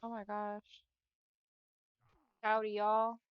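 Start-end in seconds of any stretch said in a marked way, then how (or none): 2.4-3.2 hammed-up Texan drawl greeting; exaggerated Texan cowboy greeting; mock Texan howdy. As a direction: play up the Texan accent for laughs. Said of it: "That's not how I actually say yeehaw".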